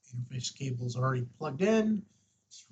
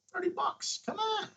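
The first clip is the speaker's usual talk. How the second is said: incredulous scoff. Real, not acted. To viewers calling the hobby too expensive, high incredulous scoff. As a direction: pitch jumps way up in mock disbelief.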